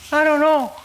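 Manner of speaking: put-on very high, loud little-kid voice; imitated high-pitched boyish answer; child's protest. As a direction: blurt it out like a cornered ten-year-old. Voices himself as child answering parents.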